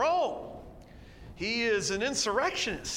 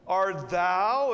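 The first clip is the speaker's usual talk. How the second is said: "thou" hit louder; stress on thou; 6 dB louder than usual. Shown as a demonstration. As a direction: lean on the word "thou". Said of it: "emphasis is on thou or you".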